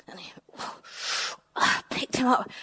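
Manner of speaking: whispered straining, lifting heavy rock; strained whisper. Acted. Finn lifting heavy rocks, strained whisper. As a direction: breathe it out with effort.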